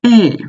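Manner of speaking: eh falling sharply from high; fourth tone eh. Shown as a demonstration. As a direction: drop eh hard from the top. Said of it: "Okay, next one".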